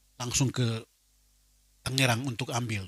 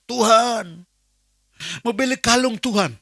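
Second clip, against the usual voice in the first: higher, earnest begging prayer; pleading prayer; 9 semitones higher, 5 dB louder, slower than usual. Retold or imitated. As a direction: plead with God like a child asking for a gift. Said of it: "Saya mulai". (doa memelas dengan suara lebih tinggi)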